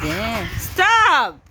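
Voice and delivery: loud, high cry of command; real scolding shout. Real, not acted. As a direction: shout it at a misbehaving pet. Scolding the animal.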